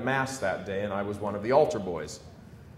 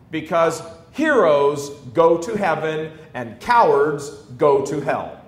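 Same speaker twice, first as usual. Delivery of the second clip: louder, slower fiery sermon voice; preaching at full force; 4 semitones higher, 7 dB louder, slower than usual. Acted. Priest praising Bishop Sheen's courage, fiery preaching. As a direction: drive it home to the congregation.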